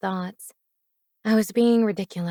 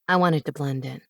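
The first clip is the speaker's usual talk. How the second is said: casual shrug; 5 semitones lower than usual. A narrator's reading. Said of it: "with a shrug".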